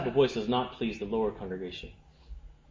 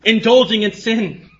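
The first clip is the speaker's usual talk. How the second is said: raised, forceful pulpit voice; impassioned preaching; 9 semitones higher, 7 dB louder than usual. Real, not acted. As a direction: preach it with conviction, high and punchy.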